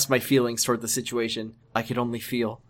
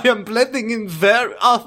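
high put-on voice, breaking into laughter; high mock doctor voice, laughing; hammed-up doctor voice; 8 semitones higher, 5 dB louder than usual. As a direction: play the fake American doctor, barely holding it together. Voicing Doctor James comically.

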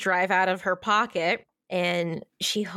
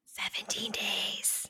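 whispered, much softer echo; 10 dB quieter, slower than usual. Real, not acted. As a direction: breathe it out as a deadpan aside. Echoing the joke under breath.

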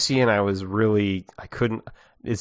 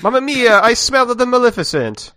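put-on high, loud hammy Italian accent; fake Italian accent; 10 semitones higher, 4 dB louder than usual. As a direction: ham up a thick Italian accent. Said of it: "he talks in a very thick Italian accent".

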